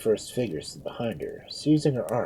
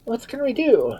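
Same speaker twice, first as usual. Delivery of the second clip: alarmed question; 4 dB louder than usual. A narrator's reading. Bystander asks priest over the frantic Duke.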